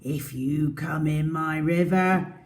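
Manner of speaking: lively read-aloud storytelling; story narration. Acted. Teacher reading the story to her class, lively. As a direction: read it to small children.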